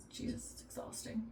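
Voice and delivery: whispered under her breath, worn out; a drained whisper to herself; exhausted whisper. Real, not acted. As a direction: breathe it out like a tired parent. Tired aside about endless meals.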